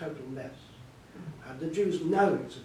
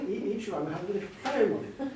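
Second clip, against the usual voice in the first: laughing; 4 semitones higher than usual. Real, not acted. Amused by poem in the loo.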